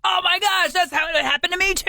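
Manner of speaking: very high mock-excited squeal of agreement; over-excited outburst. Two fellow worriers comparing sleepless nights, over-excited. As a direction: burst out high and eager, hammed up.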